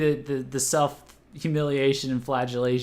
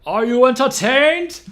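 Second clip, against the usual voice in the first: shouting; 11 semitones higher, 10 dB louder than usual. Acted. Shouting to chat in Count voice.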